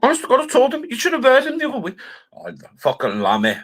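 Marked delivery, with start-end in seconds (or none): 0.0-2.0 high mock voice. High mocking voice at start.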